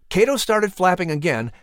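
ordinary delivery, nothing marked

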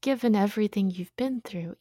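ordinary talk, nothing marked

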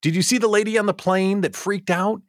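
normal talking voice

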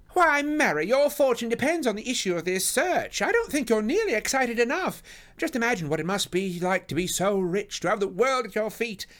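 high, loud, gushing lady; Missus Forrester, excited. Acted. Lady urges Mary to be excited, high and loud. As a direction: exclaim high and loud to stir her up.

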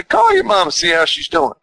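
high, coaxing inner voice nudging him; inner little voice. Retold or imitated. Inner voice urging him to call his mama, high and coaxing. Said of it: "I heard that little voice say".

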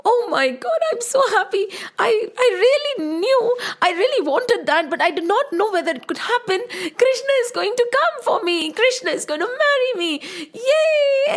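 put-on a girl squealing with happiness, near tears; laughing and crying with delight; overjoyed. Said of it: "she was so happy".

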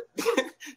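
a sudden high laugh; a yelp of real laughter; high burst of laughter. Real, not acted. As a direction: laugh out loud at the memory. Laughing at his own shock.

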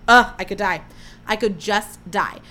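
normal talking voice

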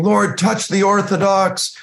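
high, drawn-out pleading prayer; imploring prayer, acted out; crying out in prayer. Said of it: "we're crying out".

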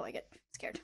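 hushed mock fear; whispering. Real, not acted. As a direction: whisper it like you're scared.

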